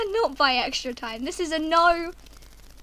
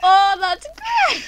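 gleeful laughing; 4 semitones higher, 8 dB louder, slower than usual. Real, not acted. Gleeful after friend falls.

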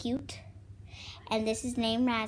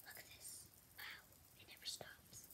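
whisper; 7 semitones higher, 33 dB quieter, faster than usual. Acted. Whispering over the spinner.

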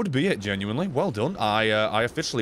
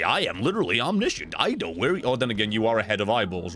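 usual speaking voice

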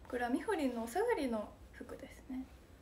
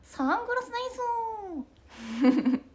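much higher, slow drawn-out call; 11 semitones higher, 4 dB louder, slower than usual. Retold or imitated. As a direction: stretch it out like a stage shout to the crowd. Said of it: "[name]のモノマネ".

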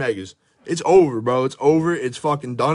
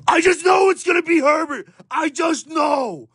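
anguished shout; 12 semitones higher than usual. Real, not acted. Collector wailing over his pack pulls, anguished shout. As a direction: yell it out like the pull is ruined. Despairing outburst over the pull.

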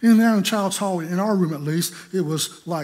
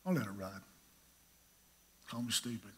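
inner thought; 6 semitones lower, 14 dB quieter than usual. Retold or imitated. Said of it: "I thought".

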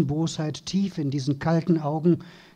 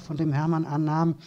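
usual speaking voice

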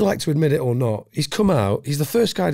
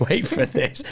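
laughing through the words, pitched up; giggling; 5 semitones higher, slower than usual. Retold or imitated. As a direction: giggle as you repeat it. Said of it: "he's giggling".

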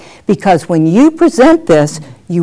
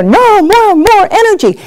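coaches pushing for energy; 11 semitones higher than usual. Retold or imitated. Producer urging the reader, high and insistent. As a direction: demand more energy, pitched way up. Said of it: "and they're".

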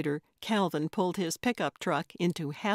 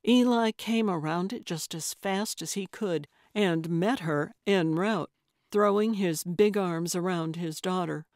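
ordinary delivery

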